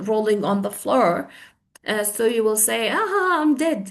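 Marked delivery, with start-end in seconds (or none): 2.9-3.8 higher, amused exclamation; laughing too much. Shown as a demonstration. Teacher repeats the phrase, acting helplessly amused. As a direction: act overcome with laughter, pitched up. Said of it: "if you're laughing too much".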